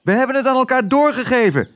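hoog vogelstemmetje; pratende spreeuw. Acted. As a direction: meld het hoog, als een spreeuw. Een spreeuw doet verslag. (hoge spreeuwenstem)

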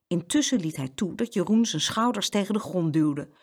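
usual speaking voice

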